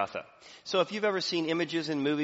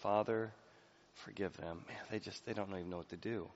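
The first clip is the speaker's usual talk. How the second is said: soft, lower, tender plea; gentle prayer; 3 semitones lower, 8 dB quieter than usual. Acted. Jesus on the cross forgiving, soft and lower. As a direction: murmur it like a dying man's prayer. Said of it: "Jesus looks at us and says".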